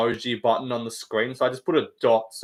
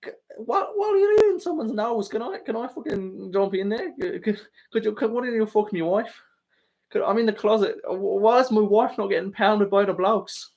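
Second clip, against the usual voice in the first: high whiny put-on voice, much higher; mock fighter impression; 7 semitones higher than usual. Streamer mocking a fighter for chat, high whiny voice. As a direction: yelp it out as a whining fighter. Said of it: "And you go".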